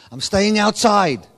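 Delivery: loud, slow, stubborn shout; defiant shout. Acted. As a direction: plant your feet and yell it slowly. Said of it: "And says".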